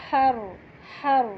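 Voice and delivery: ہر with a breathy ha and heavy ra. Shown as a demonstration. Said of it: "ہا زبر را". (ہر صاف ہا کے ساتھ)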